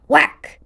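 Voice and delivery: loud cartoon whack; mock impact sound. A narrator's reading. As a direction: smack the word out like a blow. Fight scene sound effect.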